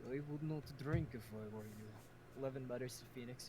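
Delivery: normal talking voice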